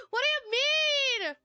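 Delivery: shocked. Real, not acted. Reacting to a teasing chat comment.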